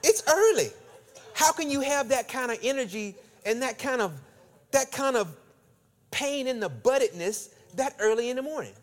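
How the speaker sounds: high, loud incredulous rant; exasperated. Real, not acted. Preacher to congregation about a rude gym man, exasperated high rant. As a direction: pitch it way up in disbelief. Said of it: "exasperated at the rude man".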